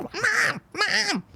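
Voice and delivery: put-on high, piercing child's call; kid calling Mom. Young John calls after his leaving mother, high and frantic. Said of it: "And John's like".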